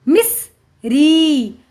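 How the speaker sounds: Miss, pause, then a long री; sounding out. Shown as a demonstration. Teacher reading to children, syllable by syllable. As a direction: split it slowly: miss... री. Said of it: "उच्चारण करके पढ़ लिया".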